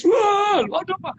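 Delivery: a father startled to see her crying again; surprised father. Retold or imitated. Father finding his crying daughter outside class, surprised.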